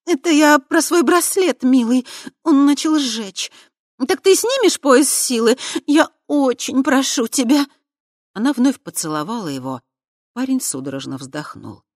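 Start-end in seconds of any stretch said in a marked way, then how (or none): 0.0-7.7 high, loud, wheedling coax; femme fatale. A narrator's reading. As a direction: sweet-talk him fast to cover. Krista covering, coaxing.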